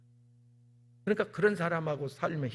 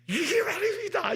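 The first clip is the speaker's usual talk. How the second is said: sudden much higher, slowed shout; excited outburst; slower than usual. Acted. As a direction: burst out in amazement. Describing the thick envelope. (깜짝 놀란 듯한 높은 외침)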